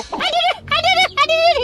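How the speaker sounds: very high squeaky cries of pain; squeaky pained creature. Acted. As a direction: yelp like a hurt little puppet. Pot kesakitan lagi. (jeritan cicit tinggi kesakitan)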